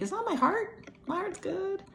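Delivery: very high-pitched mock protest; dismissive inner voice. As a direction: brush it off in a squeaky high voice. Voicing her dismissive thought back then.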